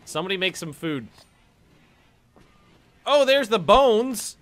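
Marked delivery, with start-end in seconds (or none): 3.0-4.4 slow, loud and delighted; excited. Real, not acted. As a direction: found it at last. Finding bones in game.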